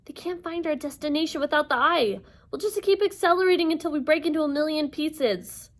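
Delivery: high, anxious warning. Acted. Annabeth to Percy in the speeding taxi, anxious warning. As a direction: warn urgently of disaster. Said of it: "Annabeth warned".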